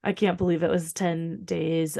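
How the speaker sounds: ordinary talk, nothing marked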